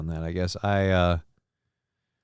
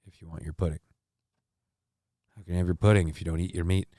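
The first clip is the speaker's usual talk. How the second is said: quoting [name]; 5 semitones lower, 6 dB quieter, much faster than usual. Retold or imitated. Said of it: "most people say".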